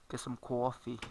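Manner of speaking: "coffee" as "cwaw-fee" in a Long Island accent; playful Long Island accent, rounded "cawffee". Shown as a demonstration. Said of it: "Long Island accent".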